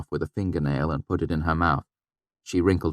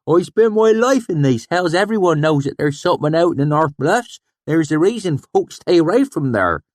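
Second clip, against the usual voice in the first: irritated swineherd; 12 semitones higher, 8 dB louder than usual. A narrator's reading. Sheem irritated at the narrator's doubt, high and loud. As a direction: put the boy in his place. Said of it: "plainly irritated".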